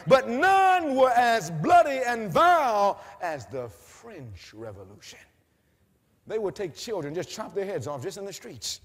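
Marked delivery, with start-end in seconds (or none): 0.1-5.2 slow loud shout falling to a hush; shout then drop. Acted. Preacher builds to a slow shout on the French Revolution, then drops.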